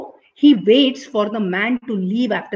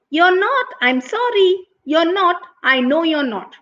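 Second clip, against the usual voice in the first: high, singsong contradiction; teasing monkey voice, pitched up; cheeky monkey; 7 semitones higher than usual. A narrator's reading. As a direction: needle the tiger in a high voice.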